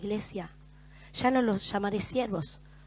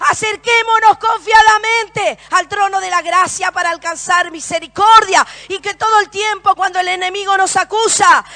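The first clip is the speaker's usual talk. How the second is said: loud, impassioned sermon delivery; fervent pulpit proclamation, driving every phrase; preaching at full force; 9 semitones higher, 15 dB louder, slower than usual. Acted. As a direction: preach it out to the whole room. Preaching to the congregation.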